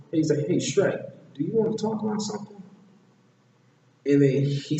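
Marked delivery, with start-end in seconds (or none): 0.5-2.6 lightly voiced eager chatterbox, pitched up; high, quick, prodding question; Donkey. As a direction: nudge a friend to open up, higher voice. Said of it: "Donkey goes up to Shrek and he said".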